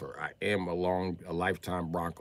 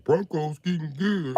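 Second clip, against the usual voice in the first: higher, slow, mock-hopeful fan voice; mocking people; 11 semitones higher, 8 dB louder, slower than usual. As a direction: parody the overhyped fans. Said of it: "people always say that".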